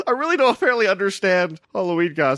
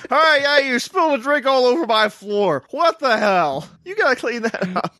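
mock annoyed host; 6 semitones higher than usual. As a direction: scold a guest over spilled drink. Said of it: "It's like".

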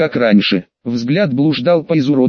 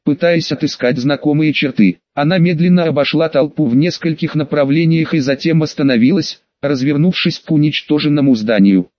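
ordinary delivery, nothing marked

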